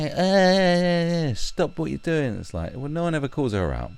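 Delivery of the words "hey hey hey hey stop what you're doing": mock call-out. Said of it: "they say".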